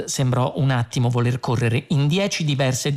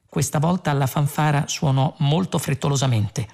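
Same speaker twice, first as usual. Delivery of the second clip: ordinary delivery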